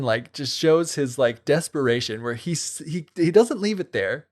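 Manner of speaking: ordinary talk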